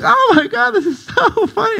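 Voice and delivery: very high squealing laugh-talk; wife laughing. Retold or imitated. Shannon laughing at the snapped wiper, squealing high. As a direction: squeal it like you can't stop laughing. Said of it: "She's like".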